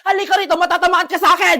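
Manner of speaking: loud, furious threat; mother shouting a threat at her son; angry threat. Acted. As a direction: threaten to hit him. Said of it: "kumalma ka".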